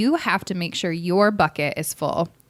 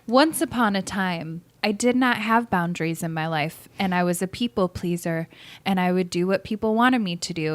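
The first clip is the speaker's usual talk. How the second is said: ordinary talk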